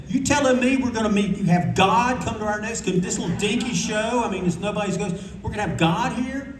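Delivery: high, amazed, can't believe it; stunned, high-pitched disbelief; incredulous disbelief. Retold or imitated. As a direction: squeal it out like news too good to be true. Disbelief at inviting Eisner.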